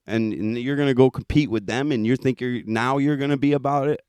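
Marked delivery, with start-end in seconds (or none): none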